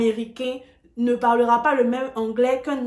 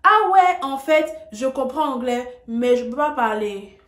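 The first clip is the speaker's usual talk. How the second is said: mocking a learner; 2 semitones higher, 6 dB louder than usual. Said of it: "ce pourquoi on entend beaucoup".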